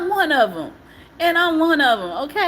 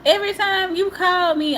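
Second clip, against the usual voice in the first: ordinary talk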